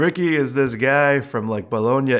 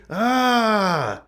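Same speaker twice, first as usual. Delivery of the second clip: frustrated cry; 8 semitones higher than usual. Retold or imitated. Frustrated outcry in story.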